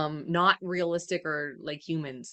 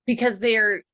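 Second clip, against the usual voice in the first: ordinary talk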